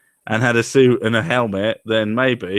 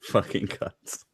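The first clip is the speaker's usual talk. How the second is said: unvoiced, slow, far softer; whispered mock repeat; 3 semitones lower, 10 dB quieter, much slower than usual. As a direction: whisper it back slowly to mock him. Repeating friend's line.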